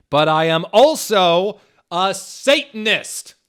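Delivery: high, loud, slow declaration; dramatic reveal. Acted. Hagrid revealing he is a Satanist, angry. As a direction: announce it darkly. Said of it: "Hagrid paused angrily".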